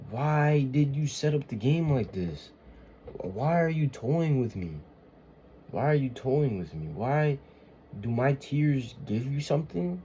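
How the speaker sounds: wounded, exasperated questioning; anguished plea to God. Acted. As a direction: plead upward, wanting an answer.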